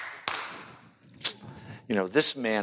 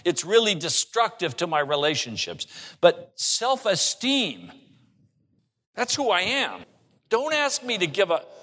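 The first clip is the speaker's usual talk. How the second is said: high-pitched mock bargaining, rising to a plea; pleading protest; 7 semitones higher than usual. Believer bargaining with the Holy Spirit, higher mock protest. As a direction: act a defensive plea, pitched well up.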